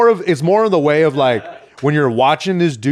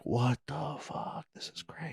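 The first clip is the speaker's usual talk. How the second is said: a fighter's stunned whisper before the fight; 19 dB quieter than usual. Retold or imitated. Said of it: "you're like".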